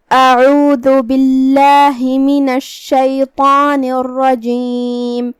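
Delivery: slow, drawn-out melodic tajweed chant; Quran recitation. Shown as a demonstration. Teacher recites the verse to students in a slow melodic chant. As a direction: recite each word slowly and melodically, letting the vowels ring.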